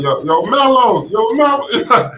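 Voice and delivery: slow, drawn-out call, pitch climbing high; calling out a name. Retold or imitated. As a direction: yell his name down to the court. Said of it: "start screaming at their names".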